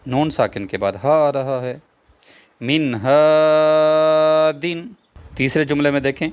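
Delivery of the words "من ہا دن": very slow, sung-out Quranic reading of من ہا دن, louder and higher, the نون said clearly with no nasal hum; drawn-out recitation. Shown as a demonstration. Teacher showing students izhar with slow drawn-out recitation. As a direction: chant من ہا دن slowly, each sound stretched, نون kept clear. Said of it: "اظہار ہی ہوگا".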